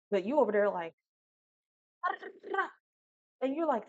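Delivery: ordinary talk, nothing marked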